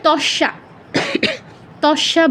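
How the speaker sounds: "তস্যা" as tosh-sha; Bengali pronunciation. Shown as a demonstration. Teacher quoting Bengali habit, "তস্যা" as tosh-sha. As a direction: use sh, no y, in "তস্যা". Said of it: "আমরা কি বলি বাংলায়".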